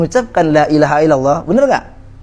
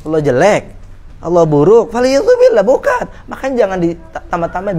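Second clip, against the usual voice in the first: ordinary talk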